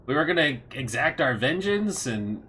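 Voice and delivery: high, loud, disappointed questioning; deflated villain, taken aback. Acted. Villain learns his enemies are already dead, incredulous letdown. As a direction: start high in disbelief, then sink. Villain's big moment is spoiled.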